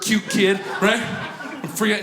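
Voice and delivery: ordinary talk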